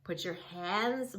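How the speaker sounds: usual speaking voice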